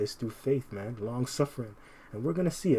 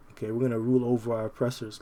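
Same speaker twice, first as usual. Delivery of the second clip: ordinary talk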